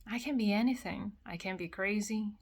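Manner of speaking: normal talking voice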